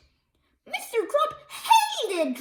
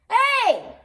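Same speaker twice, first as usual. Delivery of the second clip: shout; 6 semitones higher, 5 dB louder than usual. A narrator's reading.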